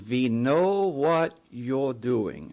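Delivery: slow, drawn-out imitation of a heavy German accent. German-accented mystery caller warning the listener over the phone, slow imitation. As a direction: imitate a German agent, stretching each word. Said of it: "with a strong German accent".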